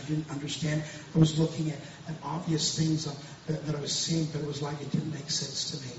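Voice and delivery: ordinary delivery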